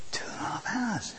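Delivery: whispered in disbelief; hushed disbelief. Retold or imitated. Preacher relives the wait at Victoria, whispered disbelief. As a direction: drop to a whisper, aghast at the wait.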